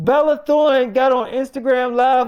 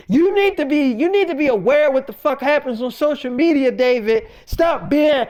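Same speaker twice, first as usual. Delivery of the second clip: ordinary talk, nothing marked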